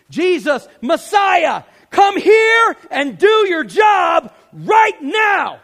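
full-force high shout, drawn out at the end; yelled demand. Acted. Preacher voicing what Bartimaeus was actually saying, full yell. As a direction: yell it as an impatient order, very loud. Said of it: "Don't leave 'cause I yelled".

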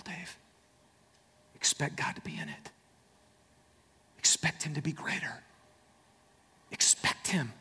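hushed, breathy self-talk; preacher murmuring truths to his own soul; whispered. Acted. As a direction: whisper it to yourself like a secret. Preacher drops to hushed inner talk.

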